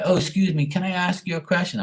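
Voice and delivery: high-pitched polite approach; imitated pleading stranger. Imitates stranger about to ask money.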